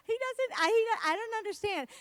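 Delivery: high, breaking-up exasperated sputter; flustered exasperation. Real, not acted. Comedian sputtering about her husband's affection, high and flustered.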